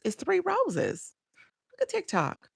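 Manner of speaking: high, slow disbelief; playful mock outrage at the gift; surprised. Real, not acted.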